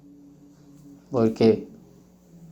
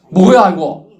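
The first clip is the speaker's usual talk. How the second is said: louder mock irritation; annoyed complaint; 5 semitones higher, 4 dB louder than usual. Friend scolding the evangelist who ran out of money, mock annoyed. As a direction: grumble like a let-down friend. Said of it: "막 화내고 가더라는 거지".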